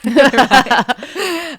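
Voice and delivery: laughing it out; a resigned laugh through the word; laughing okay. Retold or imitated. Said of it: "I'll be like".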